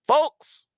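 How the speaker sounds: folks with the L sounded. Shown as a demonstration. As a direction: folks said fohlks, L clearly pronounced. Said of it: "Do you pronounce the L".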